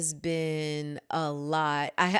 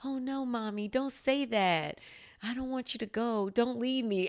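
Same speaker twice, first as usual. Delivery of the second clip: imitating son's plea; 6 semitones higher than usual. Mother voices her son begging her to stay, in a higher pleading child voice. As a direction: play the toddler begging mommy not to go. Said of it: "He was like".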